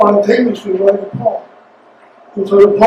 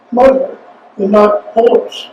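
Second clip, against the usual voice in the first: usual speaking voice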